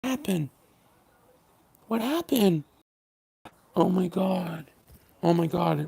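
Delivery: high, sugary fake concern; mock sympathy. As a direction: coo at him like a hurt child, sarcastically. Sarcastically consoling the commenter.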